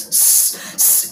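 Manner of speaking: a whispered hissing स स. Shown as a demonstration. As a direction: demo the wrong hiss स. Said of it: "ये आवाज़ आपके आवाज़ में नहीं आनी चाहिए". (गलत सीटी वाली स आवाज़)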